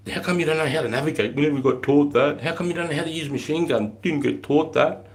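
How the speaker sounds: high, incredulous quizzing of recruits; mocking disbelief. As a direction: ask it up high as if you can't believe it. Mocking recruits' lack of training.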